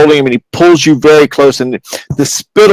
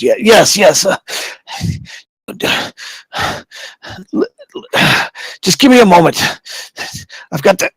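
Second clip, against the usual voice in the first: higher-pitched, halting effort; strained magistrate; 5 semitones higher, 5 dB quieter than usual. Acted. Magistrate asking the captain for a moment, straining. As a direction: answer through the strain, breaking off.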